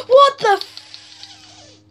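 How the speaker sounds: very high, drawn-out shocked cry; stunned at the glitch; shocked outburst. Real, not acted.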